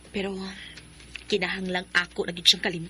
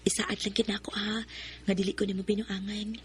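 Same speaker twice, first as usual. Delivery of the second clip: breathy confessing whisper; 7 dB quieter than usual. Acted. Shai admits to Sai she likes him, whispering. Asks for promise.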